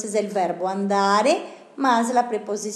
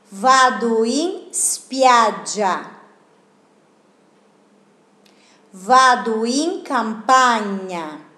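clear, deliberate read-aloud for students to echo; teacher reading the line for students to repeat; model for repetition; 3 dB louder than usual. Shown as a demonstration. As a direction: say each phrase cleanly, then pause for the class. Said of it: "leo una vez y ustedes repiten".

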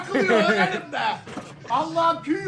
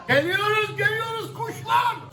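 high, gloating call; taunting shout; 8 semitones higher than usual. Real, not acted. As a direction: gloat at the rival fans. Taunting rival fans.

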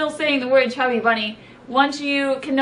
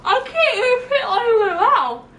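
high, laughing through a mouthful; laughing with mouth full; 11 semitones higher, 4 dB louder than usual. Real, not acted. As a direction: giggle round stuffed cheeks.

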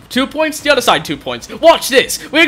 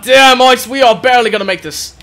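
usual speaking voice

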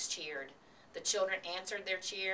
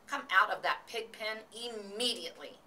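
scolding cry; 4 semitones higher than usual. A narrator's reading. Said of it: "cried Missus Arable".

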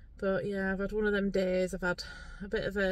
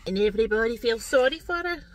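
mock Scottish accent; 3 semitones higher, 5 dB louder than usual. Imagined Glasgow viewer criticising her, mock Scottish accent. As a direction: grumble in hammed-up Scottish. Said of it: "talking bloody Scottish".

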